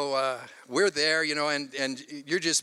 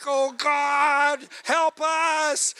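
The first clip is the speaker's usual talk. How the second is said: higher, louder desperate cry; panicked plea; 11 semitones higher, 7 dB louder, much slower than usual. Retold or imitated. Father praying as his son goes missing, panicked. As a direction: beg heaven in a panic.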